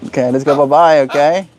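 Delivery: usual speaking voice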